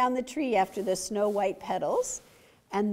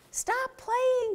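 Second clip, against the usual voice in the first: fox cries out; 13 semitones higher than usual. Acted. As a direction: call out to halt the rabbits' game. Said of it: "cried Fletcher".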